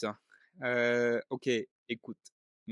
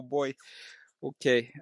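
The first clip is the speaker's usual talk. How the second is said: ordinary talk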